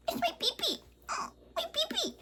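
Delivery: tiny squeaky laughing voice; squeaky silly voice. Pretend. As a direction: squeak it like a joke. Jokey squeak about her hair.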